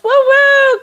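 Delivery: high celebratory whoop; long, high "woo"; cheering. Real, not acted. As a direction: cheer for the winner, high and held. Cheering the quiz winner.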